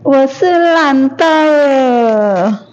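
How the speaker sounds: ordinary talk, nothing marked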